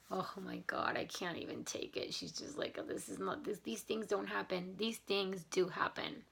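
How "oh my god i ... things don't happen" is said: mock exasperation. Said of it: "I don't wanna be like".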